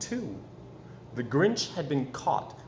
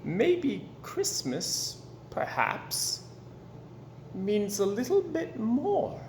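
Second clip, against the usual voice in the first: wondering thought; 7 semitones higher, slower than usual. Acted. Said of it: "he thought".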